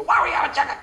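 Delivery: irritated husband. Acted. Husband grumbling at his wife about supper, mock-irritated. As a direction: act out a grumpy husband whining at dinner.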